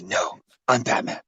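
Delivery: low hushed mock Batman. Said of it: "Batman talks like this".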